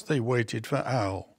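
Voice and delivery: ordinary delivery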